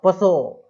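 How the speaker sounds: 'पसो' loud, with an added 'प' before 'सो'; wrong pronunciation. Shown as a demonstration. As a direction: sound the silent P loudly in 'पसो'. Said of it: "अरे क्या पढ़ते हो". ('पसो' में P बोलकर ज़ोर से गलत उच्चारण)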